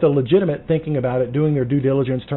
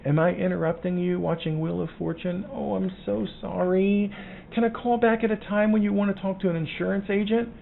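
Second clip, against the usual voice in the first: meek, overly apologetic higher voice; overly polite apology; 6 semitones higher, 3 dB quieter than usual. Shown as a demonstration. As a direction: grovel a little too politely on the phone. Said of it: "now is not the time to be like".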